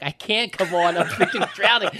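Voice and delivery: high, loud exasperated shout through laughter; laughing mock yell. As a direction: yell it back, cracking up. Shouting back while drowning, laughing.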